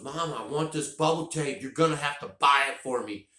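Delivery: put-on high, whining child demanding candy; whiny kid. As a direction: beg like a spoiled kid who wants it now. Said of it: "And he goes".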